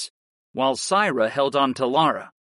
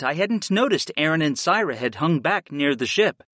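plain reading voice

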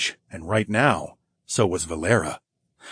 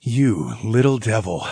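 lightly teasing; playful, affectionate jab. A narrator's reading. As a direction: tease with mock reproach. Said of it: "I teased".

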